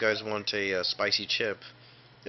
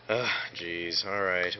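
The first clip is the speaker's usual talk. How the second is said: ordinary talk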